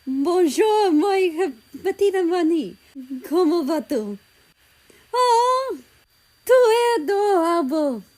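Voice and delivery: high, loud gushing French; exclaiming in French. A narrator's reading. France greeting the dog, excited French. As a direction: coo over the dog excitedly. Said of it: "she exclaimed".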